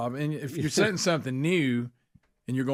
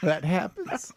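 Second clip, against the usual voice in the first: laughing; 4 semitones higher, slower than usual. Real, not acted. Joking about cutting himself out.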